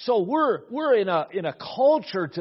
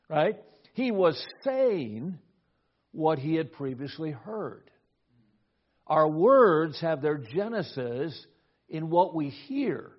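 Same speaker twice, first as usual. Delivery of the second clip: ordinary talk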